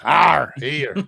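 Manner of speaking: pirate growl in West Country accent. Shown as a demonstration. Showing hosts how the West Country talks, pirate accent. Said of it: "they all speak like pirates".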